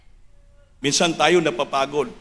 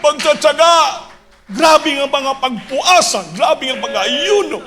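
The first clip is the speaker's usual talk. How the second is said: high, impassioned preacher's proclamation; full-force preaching; 11 semitones higher, 4 dB louder than usual. Real, not acted. Preacher extolling the pastors' perseverance to the church, full-force preaching. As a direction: build the list of sacrifices to a shout.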